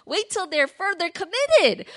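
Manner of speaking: high, loud, drawn-out protest; dismayed disciples. Acted. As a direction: plead with your leader in slow, loud disbelief. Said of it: "Can you imagine how the disciples feel?".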